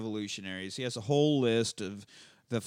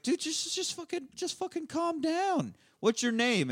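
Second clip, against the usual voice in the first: much higher, hammed-up calm-down plea; mock high voice. Hans in fake American voice telling Bruce to calm down, high mock voice. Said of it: "he's like".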